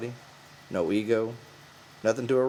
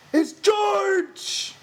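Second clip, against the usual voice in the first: much higher, louder joyful cry; excited cry; 7 dB louder than usual. A narrator's reading. Lenny crying out happily when George arrives. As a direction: burst out with glee. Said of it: "Lenny cried".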